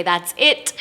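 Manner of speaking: sudden realization. Retold or imitated. Waking from a dream with the answer, excited. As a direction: burst out with the new idea.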